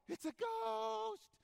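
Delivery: high, drawn-out panicked shout; terrified yell. Retold or imitated. Disciples in the boat spotting Jesus, terrified shout. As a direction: scream it like you've just seen a ghost. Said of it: "And they yell".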